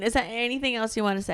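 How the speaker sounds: ordinary talk, nothing marked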